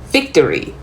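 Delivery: ordinary talk, nothing marked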